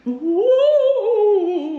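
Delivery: sung, eerie ghost moan; ghost wail. Pretend. Ghost sound effect.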